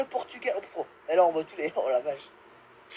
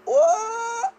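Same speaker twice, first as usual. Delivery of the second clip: shocked high what; 6 dB louder than usual. Real, not acted. Gets attacked unexpectedly.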